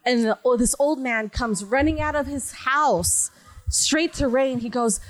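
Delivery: ordinary talk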